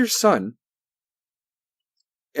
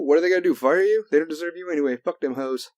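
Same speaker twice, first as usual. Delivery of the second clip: ordinary talk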